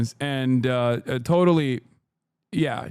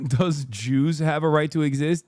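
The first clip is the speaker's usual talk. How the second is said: normal talking voice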